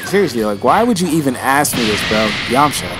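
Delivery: usual speaking voice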